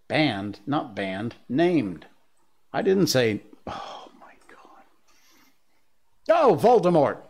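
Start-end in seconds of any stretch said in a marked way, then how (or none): 3.7-4.8 whisper. Real, not acted. Embarrassed aside.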